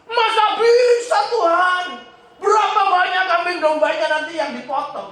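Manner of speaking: high incredulous Moses, dragging the first words; doubting Moses. Retold or imitated. Preacher voicing Moses doubting God's promise, high and scoffing. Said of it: "katanya Musa".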